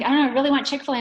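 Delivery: put-on high, very loud childlike pleading; imitated insistent daughter. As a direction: insist like a kid who won't budge. Daughter insisting in acted quarrel.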